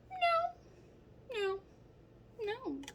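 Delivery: rising sharp no, no, no; emphatic no. Real, not acted. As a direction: shoot down the guess firmly. Emphatic denial of guess.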